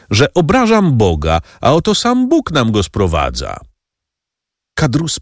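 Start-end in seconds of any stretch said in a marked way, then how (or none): none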